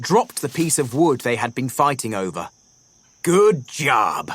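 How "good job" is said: praise. A narrator's reading. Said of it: "the bison praised them".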